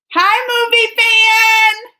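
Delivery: high, loud, drawn-out hello; big sing-out greeting, last word stretched; excited greeting. Real, not acted. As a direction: call out to a fan in delight. Calling out greeting to a viewer.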